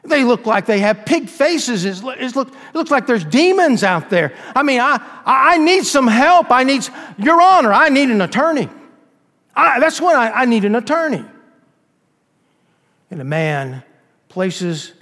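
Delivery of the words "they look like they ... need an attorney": high, loud, frantic begging; panicked plea. Acted. Accused begs the judge for an attorney, high and frantic. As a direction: cry out as if cornered by demons.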